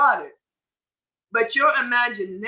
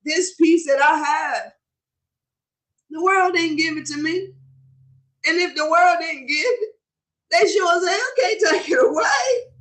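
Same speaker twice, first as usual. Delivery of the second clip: fervent preaching; 6 semitones higher than usual. Real, not acted. Reciting song words with fervor.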